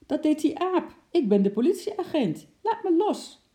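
high, loud outraged protest; furious, demand release; indignant protest. Acted. Pinned-down policeman protests to Wim, high loud outraged.

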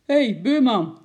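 loud, high call over the fence; roepend. A narrator's reading. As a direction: call out to the neighbour. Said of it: "riep de meneer". (luid naar de buurman roepen)

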